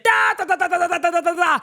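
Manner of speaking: rapid high "da-da-da" babble; high, hammered-out imitation of argument; aggressive chatter. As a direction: mimic two people jabbering heatedly. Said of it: "and it's getting aggressive".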